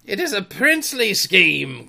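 high, emphatic cry; delighted cry. A narrator's reading. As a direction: proclaim it grandly. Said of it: "cried Hook".

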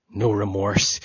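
usual speaking voice

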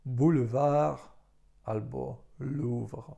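"boulevard" with OU as U, "Louvre" slowly; teacher showing OU; French pronunciation. Shown as a demonstration. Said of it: "na przykład w słowach".

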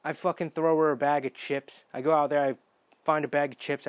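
ordinary talk, nothing marked

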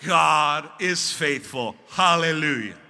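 God is faithful, slow and loud with pauses; slow emphatic declaration. Real, not acted. Pastor stirring the Pentecostal church, slow and loud. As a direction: let each word land with weight. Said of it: "one of those pause moments".

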